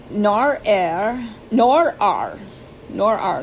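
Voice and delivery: "nor are" said slowly as nor-ar, rolled r; sounding out "nor are" carefully for a learner; Norwegian pronunciation demo. Shown as a demonstration. Learner reading a phrasebook aloud, sounding out "nor are". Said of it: "that's how they say to pronounce it".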